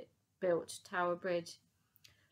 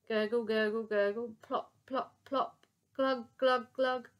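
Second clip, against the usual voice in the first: playful gurgling sounds; gurgling sinking; 3 semitones higher, 5 dB louder, slower than usual. A narrator's reading.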